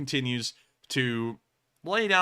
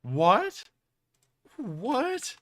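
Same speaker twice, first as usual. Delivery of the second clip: surprised; 10 semitones higher than usual. Real, not acted. Baffled by Hellfire retirement.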